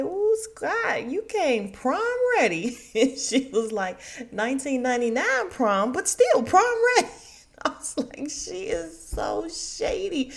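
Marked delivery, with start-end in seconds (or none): 0.0-2.8 high, swooping catcall; Quad's mock gushing. Woman teasing Scott as he walks up, mock gushing. 4.3-7.1 loud, climbing to a high whoop; Quad's shady teasing. Retold or imitated. Woman shading Scott's prom look, gleeful mock praise. 8.3-10.3 high, slow laughing squeal; amused disbelief. Retold or imitated. Viewer reacting to the shade, laughing disbelief.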